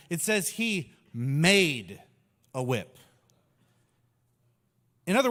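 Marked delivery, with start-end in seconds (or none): 0.1-3.0 "made" drawn out slowly, set against "grabbed"; stress on made. Shown as a demonstration. Correcting the text, stress on "made" said slowly. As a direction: lean on "made" slowly, then drop on "a whip". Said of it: "It doesn't say he grabbed a whip".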